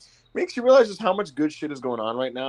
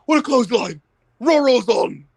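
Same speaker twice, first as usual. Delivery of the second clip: higher, louder mock announcer, huffing the call; mock out-of-breath announcer; 11 semitones higher, 6 dB louder than usual. Mocking the announcer for his friends, higher and louder. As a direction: ham up a winded commentator throwing to break. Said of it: "He'll be like this".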